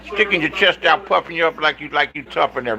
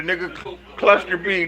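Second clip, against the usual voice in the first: ordinary delivery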